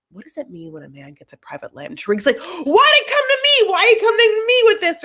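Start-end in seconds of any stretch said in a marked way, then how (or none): 0.1-1.9 very soft, quick, lower voice; mock probing question. Voices host turning to a guest. 2.4-5.0 very high, fast, loud squeal; mock panicked protest. Imitates guest protesting being questioned.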